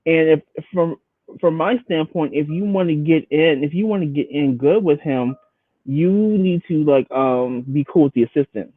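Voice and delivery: usual speaking voice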